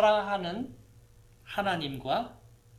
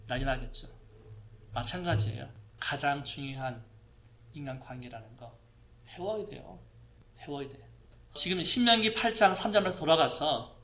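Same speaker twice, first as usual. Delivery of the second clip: ordinary talk, nothing marked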